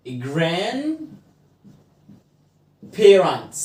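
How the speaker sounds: very slow, drawn-out GRANDPARENTS with a pause inside; GRAND... PARENTS, stretched for learners; slow word demonstration. Shown as a demonstration. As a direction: say grandparents slowly in two separate parts. Slow word demo in lesson.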